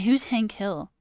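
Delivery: ordinary talk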